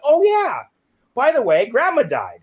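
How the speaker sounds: loud, high, cheerfully offhand; breezy afterthought. Retold or imitated. Telling mom on the phone grandma died, breezy. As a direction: blurt it out like trivial good news. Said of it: "I said it like that".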